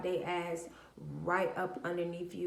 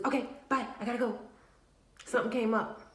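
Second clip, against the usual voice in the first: hurried brush-off; 5 semitones higher, much faster than usual. Retold or imitated. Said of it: "What they'll do is".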